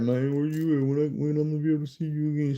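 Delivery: put-on fast, slurred drunk pleading. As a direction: slur it like a drunk caller. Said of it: "I guess dude was drunk".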